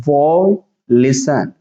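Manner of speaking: very slow, stretched "violation"; syllable by syllable. Shown as a demonstration. Teacher showing students how to read it, slowly broken up. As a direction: draw out "violation" as separate parts with pauses. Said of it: "इसको पढ़ेंगे".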